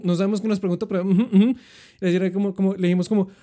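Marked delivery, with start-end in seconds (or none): 1.0-1.5 nodding ujú. Retold or imitated. Nodding along, not understanding.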